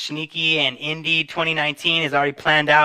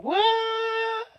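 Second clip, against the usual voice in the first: very high, drawn-out squeal of "what"; astonished reaction. Retold or imitated. Host retelling his reaction to a sponsorship offer, astonished. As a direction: stretch it out in a high mock-amazed whoop.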